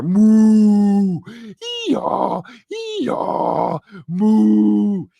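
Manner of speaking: loud high moo and braying; imitated animal noises. Imitating drugged inmate in his cell, animal noises. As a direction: moo like a cow, squeal hee-haws. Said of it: "he's making animal noises".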